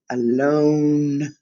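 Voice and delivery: drawn-out Alone, not shouted. Shown as a demonstration. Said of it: "So I guess he didn't shout it".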